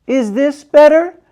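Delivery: put-on high, loud, eager girl; loud child voice. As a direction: still booming when told to hush. Loud girl testing volume.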